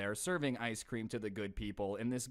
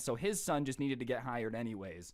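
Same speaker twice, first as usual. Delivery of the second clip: ordinary talk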